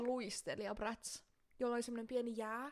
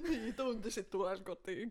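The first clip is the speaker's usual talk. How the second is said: mock reply, laughing; 4 dB louder than usual. Friend mock-voices the kid.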